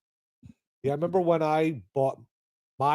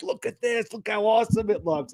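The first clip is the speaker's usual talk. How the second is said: excited show-off; 6 semitones higher than usual. Retold or imitated. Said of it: "He's like".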